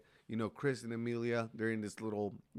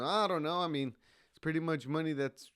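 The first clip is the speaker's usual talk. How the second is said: higher and louder, shrugging it off; mock-innocent shrug in a raised voice; sheepish excuse; 7 semitones higher, 5 dB louder than usual. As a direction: play innocent, caught red-handed. Retold excuse for taking money.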